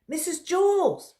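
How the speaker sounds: loud, high call across the room; a boy calling out to his teacher. Acted. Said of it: "he called".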